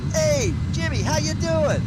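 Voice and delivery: high, hammed-up Boston greeting; Boston guy voice. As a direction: call out like a Boston local in an ad. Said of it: "native Bostonians to film the commercial".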